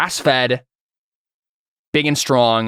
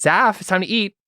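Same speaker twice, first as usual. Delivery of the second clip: mom calling; 7 semitones higher than usual. Acted. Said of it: "calls again".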